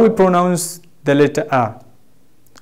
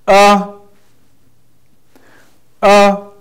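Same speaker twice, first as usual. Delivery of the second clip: short, high, loud "Uh"; fourth tone drill; 6 semitones higher, 5 dB louder than usual. Shown as a demonstration. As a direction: call out "Uh" briefly on a high pitch. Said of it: "Everybody pronounce".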